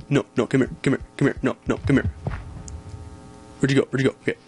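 usual speaking voice

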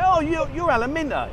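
mock greeting. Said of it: "When you go in and say".

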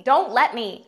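shouting; loud shout. Shown as a demonstration. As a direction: yell it out loud.

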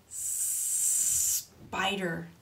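SPIDER with a long hissed S; slow hiss into the word spider; long snake sound. Shown as a demonstration. Teacher reads to children, long S.

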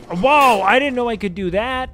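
sudden high, loud whoa of real surprise; genuinely amazed outburst; surprised whoa. Real, not acted. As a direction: gasp it out, caught off guard. Surprise at a new game move.

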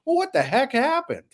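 high, baffled what-happened; baffled disbelief. Retold or imitated. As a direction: ask it in stunned disbelief. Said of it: "and I was like".

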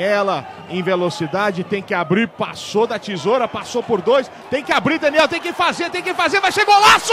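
rising from fast to a yell; commentary building to a goal call. Real, not acted. Commentator calls attack building to goal.

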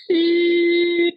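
long, high steady beep; imitated tinnitus beep. Explaining tinnitus to the host, imitated high beep. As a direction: hold a high tone like ringing ears.

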